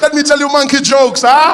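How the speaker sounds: high, loud hammed-up jungle comedian; mock stage act. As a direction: ham it up for the crowd. Imagined jungle comic.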